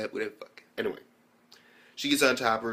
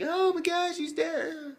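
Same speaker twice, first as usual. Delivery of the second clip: high-pitched imitation of wailing; mock bawling; slower than usual. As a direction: wail it in hammed-up tears. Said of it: "Amelia fucking bawling her eyes out".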